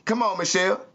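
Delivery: ordinary talk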